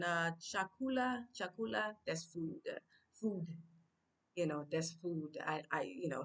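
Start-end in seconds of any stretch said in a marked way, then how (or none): 0.4-1.9 "Chakula" called higher, asking around; Swahili word. Shown as a demonstration. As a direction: call out Chakula to a passerby. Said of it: "I'll ask someone".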